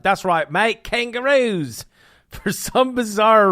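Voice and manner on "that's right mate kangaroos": a hint of an Aussie drawl, kangaroos pitched up and stretched slow; playful Australian accent, teasing the audience; mock Australian accent. Host teasing viewers about Aussie snacks in a light Australian accent. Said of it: "I'm the worst at accents".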